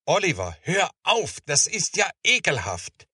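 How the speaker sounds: high-pitched, exclaiming disgust; disgusted scolding. A narrator's reading. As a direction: read it as a child's revolted protest. Anita disgusted, tells Oliver to stop.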